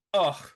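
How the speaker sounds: loud, short mock "ugh"; imitated audible oomph. Podcast host recounting Kat's reaction, imitated oomph. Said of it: "she made an audible oomph".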